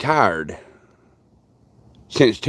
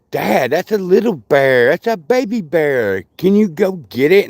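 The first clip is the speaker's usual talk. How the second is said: lightly voiced child, pitched up and pleading; a small girl begging her dad, rising as she goes; imitating little daughter; 5 semitones higher than usual. Daughter asking dad to fetch the bear cub, in a higher child's voice. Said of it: "Bug Bug goes".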